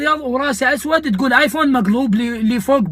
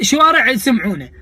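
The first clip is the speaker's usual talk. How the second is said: normal talking voice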